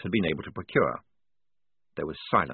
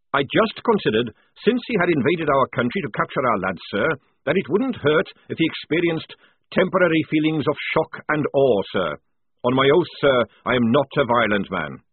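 higher, louder sergeant reporting to an officer; sergeant's earnest self-justification; sergeant protesting innocence; 4 semitones higher, 4 dB louder than usual. Acted. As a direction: plead your innocence loudly, sir. Voices the sergeant answering the officer.

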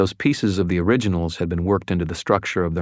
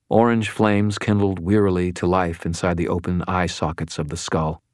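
usual speaking voice

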